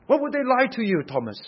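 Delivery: high, loud pointed question; challenging rebuke. Acted. Preacher as Jesus questions Thomas's distrust, challenging. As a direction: confront the doubter, voice jumping up.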